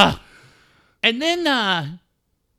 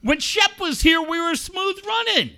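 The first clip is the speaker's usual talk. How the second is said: higher, heated rant at Joe; pretend scolding; 8 semitones higher, 4 dB louder than usual. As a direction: chew him out as Steve, rising in pitch. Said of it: "if I'm Steve, I'm like".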